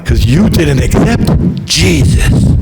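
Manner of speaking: slow, grave pronouncement of doom; solemn heavenly judgment. Acted. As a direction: intone it like a judge of heaven. Heavenly court gives reason.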